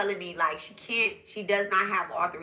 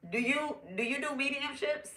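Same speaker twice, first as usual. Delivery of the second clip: higher, softer quoted voice; imitating tentative question; 7 semitones higher, 7 dB quieter than usual. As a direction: lightly mimic the reader asking softly and higher. Quoting the reader's tentative question.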